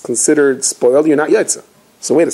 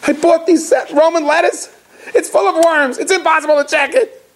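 much higher, fretful widow's voice; upset widow; 13 semitones higher than usual. Retold or imitated. Widow at the rabbi's door, upset about her lettuce, high fretful voice. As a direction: play the worried widow, pitched way up. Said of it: "She goes".

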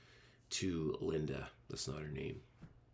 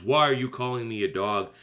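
acted loud, affronted retort; self-righteous indignation; 5 semitones higher, 10 dB louder than usual. Imagined woman answering Jesus, self-righteous indignation. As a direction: protest loudly, pitched up, as if insulted. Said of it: "got all self-righteous".

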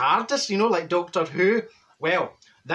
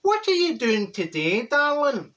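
higher, slow oily greeting; sleazy neighbour; 8 semitones higher, slower than usual. Retold or imitated. Neighbour Fat Boy creeping up to greet her, slow and oily. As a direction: drag out darling like a creep. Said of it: "he's saying".

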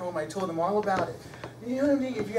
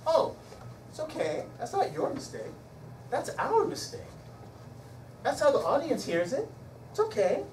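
upbeat reassurance; 7 semitones higher than usual. Shown as a demonstration. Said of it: "you know what I say".

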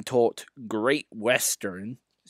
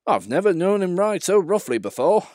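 higher, louder driver; a hint of worried driver; 9 semitones higher, 6 dB louder than usual. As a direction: remark it over the noise. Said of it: "remarked the driver".

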